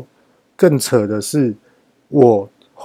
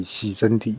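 ordinary talk, nothing marked

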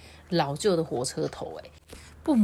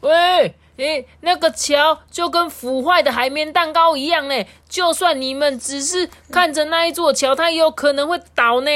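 loud, higher yelled warning; shout; 8 semitones higher, 6 dB louder than usual. Acted. The farmer shouts a warning to Dr. Boom about the bridge. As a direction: bellow the warning across the field. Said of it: "他在那边大吼说".

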